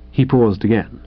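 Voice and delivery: ordinary delivery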